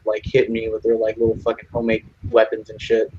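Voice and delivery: ordinary delivery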